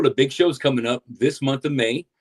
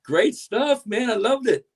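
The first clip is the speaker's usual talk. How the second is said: enthusiastic; 10 semitones higher, slower than usual. Real, not acted. Host praising the guest's concert footage.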